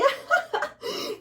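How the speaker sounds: high giggling laugh; childlike laugh. Acted.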